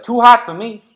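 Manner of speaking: very high, loud, mocking thief squeak; shrill-free cartoonish high taunt, loud. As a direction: tease the guard as you flee. GM voices thief taunting guard.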